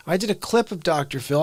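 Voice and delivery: normal talking voice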